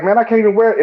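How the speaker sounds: high, loud dismayed protest; complaining about the hat rule; mock dismay. Visitor told to remove hat, mock dismay. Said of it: "you be like".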